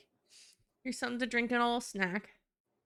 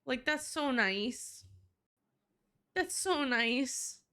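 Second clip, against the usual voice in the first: slow high swoon over a sweet game moment; gushing; 4 semitones higher, much slower than usual. Real, not acted. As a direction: melt over it slowly.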